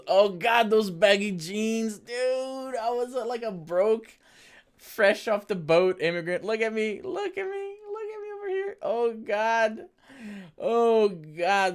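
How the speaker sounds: high, loud embarrassed squealing; cringing squeal. Real, not acted. Cringing at his old self.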